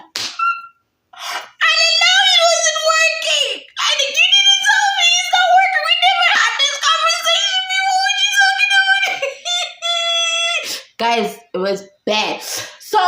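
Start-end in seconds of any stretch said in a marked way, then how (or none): 0.0-9.1 very high, loud, half-sung disbelief; shocked wailing protest. Retold or imitated. Reacting to her boyfriend's breakup text, shrieking disbelief. 9.1-11.0 a high, sung cackle trailing off; high sing-song laugh. Real, not acted. Laughing at her own breakdown over the breakup, sing-song laugh. After wailing protest.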